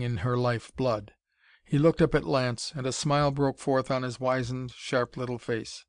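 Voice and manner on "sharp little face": usual speaking voice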